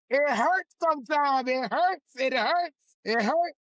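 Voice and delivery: getting emotional. Real, not acted. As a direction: voice rising with real feeling. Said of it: "You're making me emotional now".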